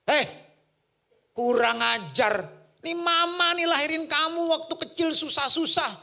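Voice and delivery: loud, high scolding, slowed on key words; scolding mother. Retold or imitated. Mother yelling at her son for grabbing food without greeting her, loud angry scolding. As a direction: scold a rude child at full volume. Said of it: "mama saya langsung pasti teriak".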